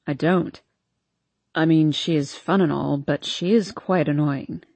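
lightly voiced young man; low male voice. A narrator's reading. Harden admits lowly.

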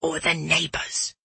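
soft, hushed afterthought; whispered warning. A narrator's reading. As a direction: add it softly under the breath. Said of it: "he whispered".

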